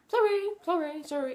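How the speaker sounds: high, rattled-off empty sorries; dismissive quick sorries. Retold or imitated. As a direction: toss off the apology without meaning it. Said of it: "a sorry is just".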